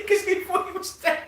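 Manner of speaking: laughing as he says it. Real, not acted. Friend finishing a war anecdote, laughing. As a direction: let the laugh carry the words.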